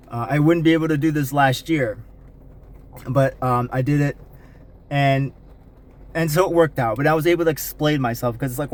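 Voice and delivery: normal talking voice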